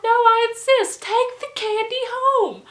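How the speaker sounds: high, loud hammed-up holler; emphatic insistence. Party host overruling visitors' refusal of candy, hollering. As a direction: imitate a drunk host who won't relent.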